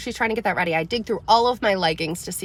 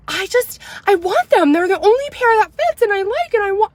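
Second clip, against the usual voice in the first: put-on high, loud, whining little girl; whiny upset kid; 11 semitones higher, 6 dB louder than usual. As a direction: mimic a frustrated daughter pleading. Daughter upset and angry.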